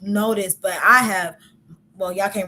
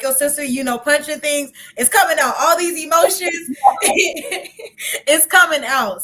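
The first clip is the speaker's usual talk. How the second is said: building higher and louder into a laugh; worked-up, half-laughing outburst; emotions bursting out; 5 semitones higher, 5 dB louder than usual. Shown as a demonstration.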